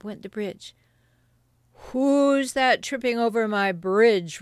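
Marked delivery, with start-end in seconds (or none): 1.9-4.4 loud, menacing troll challenge; troll's roar. Acted. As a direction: challenge the trespasser loudly as a troll. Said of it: "roared the troll".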